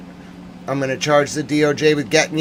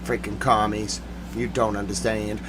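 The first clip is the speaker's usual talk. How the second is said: low, much softer grumble; sulking; 6 semitones lower, 11 dB quieter than usual. Real, not acted. Worn out after ranting at commies, sulking quietly. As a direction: drop down and grumble to yourself. Sulky drop after outburst.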